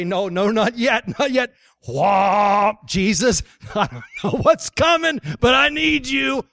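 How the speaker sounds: loud, comic begging for a moment to get ready; mock panicked plea. Bracing himself, comic panicked plea.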